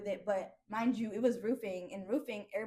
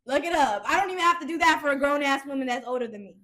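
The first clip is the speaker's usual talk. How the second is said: loud, insistent "look it up"; insistent shout; 4 semitones higher, 8 dB louder than usual. Real, not acted. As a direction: keep hammering "look it up" louder. Insisting loudly to friend.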